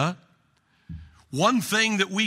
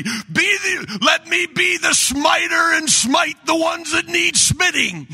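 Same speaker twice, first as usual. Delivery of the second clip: shouted much higher and louder; mock biblical prophet; 11 semitones higher, 6 dB louder than usual. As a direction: bellow like a vengeful Old Testament prophet. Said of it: "in Bruce Almighty".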